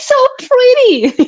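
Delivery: very high, loud gush; squealed mock compliment; gushing admirers. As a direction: imitate people cooing over an accent. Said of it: "then everybody says".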